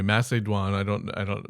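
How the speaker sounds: usual speaking voice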